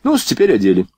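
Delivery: ordinary delivery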